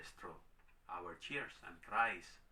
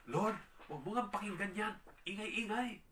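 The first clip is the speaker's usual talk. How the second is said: high-pitched, irritated griping; complaining disciples; 10 semitones higher than usual. Retold or imitated. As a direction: imitate disciples whining to the Lord about the noise. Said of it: "Parang ganun, no?". (nagrereklamo, mataas at inis na boses)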